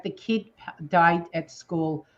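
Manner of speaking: usual speaking voice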